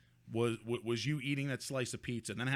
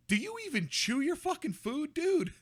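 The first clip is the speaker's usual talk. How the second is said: shrieking in disbelief, much higher; incredulous; 4 dB louder than usual. Real, not acted. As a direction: squeal it in shocked disbelief. Shocked at friend's eating video.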